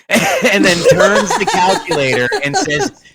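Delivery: talking through laughter, slow; can barely get the words out for laughing. Real, not acted. Laughing while retelling wife's retort.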